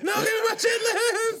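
put-on high, slow whining child voice; mock tantrum for the chitlins, high and whiny; whiny kid. S1 teases S0 about chitlins, squeaky child whine. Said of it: "kicking and screaming".

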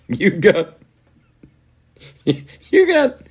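high-pitched laugh breaking through the words; cracking up while trying to talk; giggling through the words. Real, not acted.

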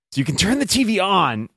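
high, disbelieving mock complaint to the TV; exasperated. Exasperated quote at the TV.

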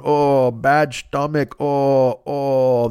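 slow, lightly put-on groaning complaint; stranger's sick moan. Old bit with a friend, slow mock groaning. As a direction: drag out the bellyache like a stranger in a store. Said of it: "a bit on me and Mike Toomey going".

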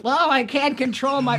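put-on high, warbling old lady; old woman voice. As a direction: quaver like a frail old woman. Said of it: "some old woman going".

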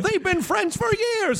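squeaky punchline. Pretend. Joke punchline in comic voice.

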